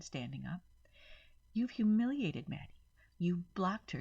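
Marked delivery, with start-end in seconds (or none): 1.5-4.0 louder, higher accusing rant; angry accusation. A narrator's reading. Rose louder and higher, accusing her sister angrily. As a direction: hurl the blame at her sister. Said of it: "You heard me," Rose said".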